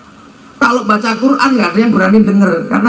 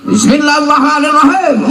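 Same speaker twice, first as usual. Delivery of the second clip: fierce recitation; 7 semitones higher than usual. Shown as a demonstration. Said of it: "Kalau sampean kelas Sayyidina Umar mestinya tadi".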